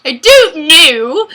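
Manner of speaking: slow, loud botched Australian-turned-English accent; accent gone English. Shown as a demonstration. Giving up on the dare, hammed-up botched Australian accent. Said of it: "now it became English".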